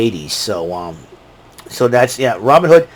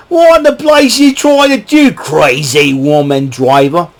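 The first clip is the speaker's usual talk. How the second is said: Cruella de Vil voice; slower than usual. Retold or imitated. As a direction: play her as a cartoon villainess scolding a bad driver. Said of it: "Cruella de Vil's going".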